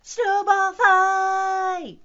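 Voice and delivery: high, slow battle cry; loud, drawn-out rallying shout; excited yell. A narrator's reading. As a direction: yell it out, stretching the last word. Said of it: "yelled Froggy".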